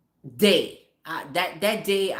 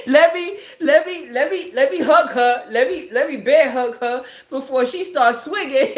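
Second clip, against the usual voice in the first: higher, loud, laughing as the scared son; laughing panicked son; 5 semitones higher, 9 dB louder than usual. Retold or imitated. Mother telling the crowd how her son panicked, laughing. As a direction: cackle through the son's begging.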